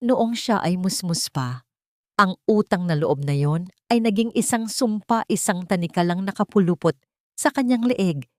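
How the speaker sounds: ordinary delivery, nothing marked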